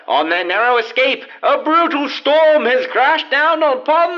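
dramatic serial announcer. A narrator's reading. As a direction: build suspense like an old radio host. Radio serial intro narration.

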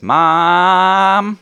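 put-on long, loud, high kid's yell; drawn-out shout of a running boy; calling for Mom. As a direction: holler for your mother from across the yard. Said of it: "he starts running towards the house and he says".

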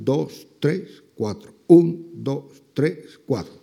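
counting the tangos rhythm for the class. Shown as a demonstration. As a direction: pulse the four-beat count, strong on "un". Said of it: "Hacemos un".

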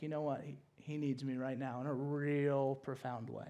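low, soft, thoughtful voice; hushed, caring resolve; tender concern. Acted. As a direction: speak with quiet compassion. Imagines Jesus deciding to comfort Paul.

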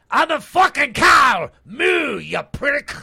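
put-on very loud, high Irish-accented shout; Irish cow. Doing regional cows, shouted angry Irish cow.

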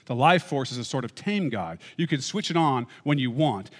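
quotation read with lifted, earnest voice; measured reading of a book passage; reading a quote. Acted. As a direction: read the passage aloud like a lecture. Reads C S Lewis quote aloud.

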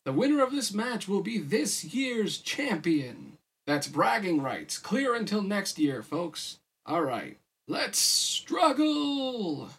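high, showy ring announcer; announcer build to a long, high-pitched cry; emcee announcing. A narrator's reading. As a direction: hype the crowd, then draw out the final call. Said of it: "the M C announced".

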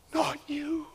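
whispered, heartbroken; whispered plea. Pretend. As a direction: breathe it out, barely audible. Pained disbelief fading to whisper.

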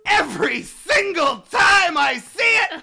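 high, loud cackling ghost voice, laughing through the words; manic laughing ghost. Acted. Ghost bragging to his interviewer, screeching with laughter. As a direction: shriek it out like a gleeful ghost.

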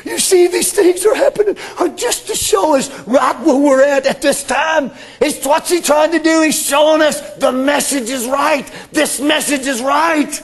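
high, loud, hammering preacher; preaching shout. Acted. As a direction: drive the message home. Preaching at full force.

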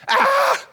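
high, loud shocked yelp; sudden shocked cry; startled yelp. Retold or imitated. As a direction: yelp like you've been caught off guard. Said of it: "I'm like".

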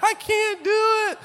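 very high, whiny mock sobbing; high, slow crying apology. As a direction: wail it like a sobbing child. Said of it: "I'm crying".

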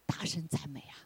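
mock quiet objection; quiet objector. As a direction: hush it like a timid doubter. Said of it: "有人就会说".

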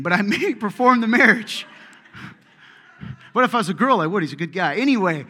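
loud, laughing joke about the wedding; laughing loudly. Real, not acted. Joking about marrying friend.

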